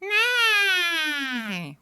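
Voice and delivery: long, high nasal twang, sliding down; high nasal whine; nasalized twang. Shown as a demonstration. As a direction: hold a high twangy note through the nose. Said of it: "and that's actually nasalized".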